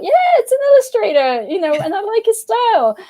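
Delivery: high, breezy enthusiasm; chirpy mock excitement; excited naive thought. Retelling her carefree first thought.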